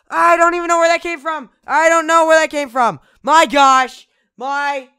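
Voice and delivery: loud, high, rushed shocked outburst; startled shout. Real, not acted. Gamer yelling after a jump scare, startled shout. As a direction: yell it, still jolted from the scare.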